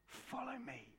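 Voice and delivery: high, hushed invitation; soft call. Acted. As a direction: call gently, lifted and light. Jesus' call, acted softly.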